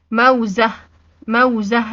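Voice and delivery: "موزة" stopped on an audible h, firmly voiced; final ة as H. Shown as a demonstration. Teacher modelling the tied taa, final ة as h in "موزة". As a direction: pause on "موزة" so its ة turns into h. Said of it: "اتنطقت هاء لأني وقفت عليها".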